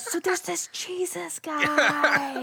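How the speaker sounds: slow, hushed hype about Jesus; upbeat youth pastor. Retold or imitated. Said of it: "your youth pastor voice".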